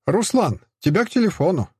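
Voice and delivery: wife calling out roughly; rude call. A narrator's reading. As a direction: call him rudely to the phone. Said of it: "Неожиданно грубо прозвучал голос Алёны".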